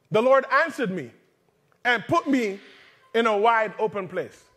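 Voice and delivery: preacher driving the verse home to the congregation; preaching. Real, not acted. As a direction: proclaim the verse with full force. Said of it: "verse five says".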